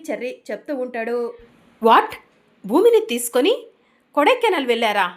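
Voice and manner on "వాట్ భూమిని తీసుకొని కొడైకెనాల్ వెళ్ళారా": sudden loud "what", the pitch jumping up on భూమిని; shocked question. Acted. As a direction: blurt it out in disbelief. Brother hears shocking news.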